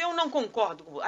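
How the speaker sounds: loud, mildly heated mock objection; acted objection. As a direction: cut in loudly as the disagreeing person. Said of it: "a pessoa assim".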